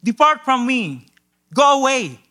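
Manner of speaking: anguished plea. Retold or imitated. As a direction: cry out to send him away. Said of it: "he says".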